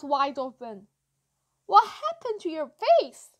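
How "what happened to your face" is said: high, loud gawking question; shocked question. A narrator's reading. Boy asking about a face, shocked blurt.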